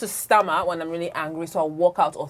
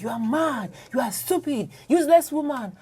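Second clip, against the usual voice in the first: insulting spouse; 6 semitones higher than usual. Retold or imitated. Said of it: "literally use words like".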